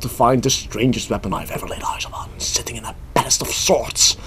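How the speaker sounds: hushed whisper reading. Shown as a demonstration. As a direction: whisper it like a secret. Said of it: "you have to do it like this".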